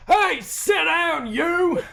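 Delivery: high, slow shouted command. Acted. Someone in the crowd shouts at Cornelius, high and slow. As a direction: bark it across the room. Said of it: "someone shouts".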